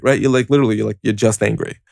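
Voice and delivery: ordinary talk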